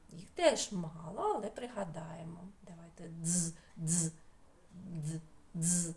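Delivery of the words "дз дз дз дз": soft, separate buzzes of «дз»; окремий звук. Shown as a demonstration. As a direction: sound out «дз» alone, pausing between. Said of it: "з таким звуком". (тихе окреме «дз»)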